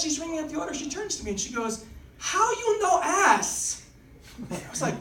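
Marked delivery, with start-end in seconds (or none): 2.2-3.8 slow, high mock Chinese accent. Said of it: "I was picking up Chinese food".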